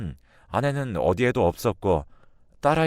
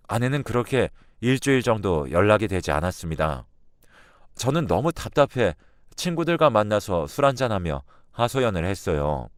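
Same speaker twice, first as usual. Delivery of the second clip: plain reading voice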